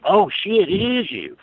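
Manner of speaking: high, startled exclamation; mock shocked manager. Manager recognizing the girl on the poster, startled. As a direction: imitate a stunned manager. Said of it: "And they would freak out".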